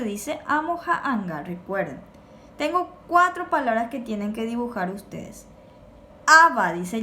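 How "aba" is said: "Aba" said loudly with an accented A; loud model "Aba", accent on the A; stress on A. Shown as a demonstration. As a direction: hit the A of "Aba". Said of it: "lleva acento en la A".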